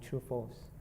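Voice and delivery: normal talking voice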